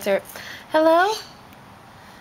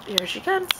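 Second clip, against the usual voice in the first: ordinary delivery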